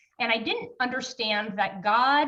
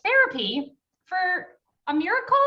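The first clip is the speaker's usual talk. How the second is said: higher-pitched puzzled query; incredulous retold question; 7 semitones higher, 4 dB louder than usual. Husband questions wife about therapy for a miracle, incredulous. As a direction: imitate a baffled husband's question.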